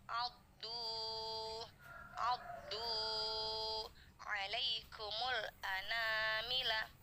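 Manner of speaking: slow, drawn-out tajwid recitation; Quran recitation. Shown as a demonstration. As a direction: chant the verse slowly, every vowel stretched. Recites the verse for students. (bacaan tartil yang pelan)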